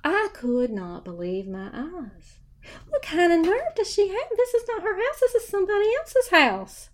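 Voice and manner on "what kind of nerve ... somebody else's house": high-pitched retold outrage; hammed-up indignation, high voice; indignant disbelief. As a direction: protest in scandalised disbelief, pitched way up. Acting indignant thoughts about client.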